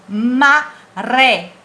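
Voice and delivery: MARÉ em sílabas, com o É bem aberto; som aberto. Shown as a demonstration. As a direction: separar "Maré" e abrir o RÉ final. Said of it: "abre o som de acordo com o acento agudo". ("Maré" silabado, E aberto e forte)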